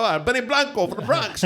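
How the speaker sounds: high-pitched, delighted quote; excited mock exclamation. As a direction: call it out high, on the edge of laughter. Acting out excited recognition of actor.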